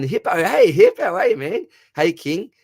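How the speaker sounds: rising to a squeal of welcome; excited greeting. Real, not acted. Streamer hails chatter Hippo with a very high loud greeting.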